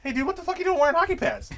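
high, fast incredulous yell; mock outburst. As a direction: rattle it off in disbelief. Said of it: "And be like".

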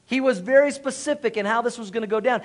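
normal talking voice

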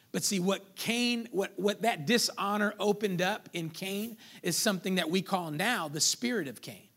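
ordinary talk, nothing marked

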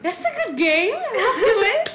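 put-on high and loud, eager child; excited kid. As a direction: sound thrilled about the game. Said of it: "So I was like".

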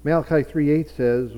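normal talking voice

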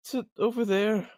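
lightly acted tearful whine; tearful pleading. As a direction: plead through tears for the mule. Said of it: "he's tearing up".

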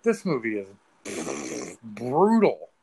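slow, loud drawn-out verdict; stunned reaction. Retold or imitated. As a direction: land it heavily, dragging the key word. Retold stunned reaction to film.

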